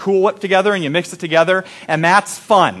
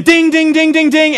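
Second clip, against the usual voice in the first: mock bell ringing; 9 semitones higher, 4 dB louder than usual. Cook rings the seconds bell.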